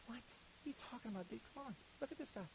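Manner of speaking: disbelieving prophet, half under breath; Samuel protesting. Retold or imitated. Samuel arguing with God over the son, incredulous. Samuel voiced in Bible retelling.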